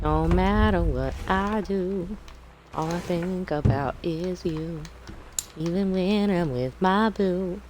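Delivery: slow, high sing-song Southern belle drawl. Pretend. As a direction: read it like a dreamy Southern belle. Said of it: "I would do dumb voices".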